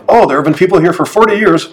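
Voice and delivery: a touch of mock praise for a foreigner; mock Estonian local. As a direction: lightly mimic a condescending Estonian. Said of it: "most common phrase ever said".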